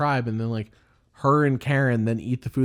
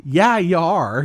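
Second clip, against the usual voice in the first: louder, higher retort; fed-up host; 5 semitones higher, 6 dB louder, much slower than usual. Retold or imitated. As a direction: imitate an exasperated comeback. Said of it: "fed up a little bit with people".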